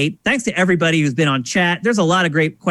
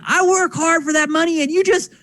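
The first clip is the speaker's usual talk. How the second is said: much higher, indignant grandfather rant; mock grandpa fury, pitched way up; angry grandfather; 13 semitones higher than usual. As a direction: play the grandfather outraged over wasted money. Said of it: "And so he's like".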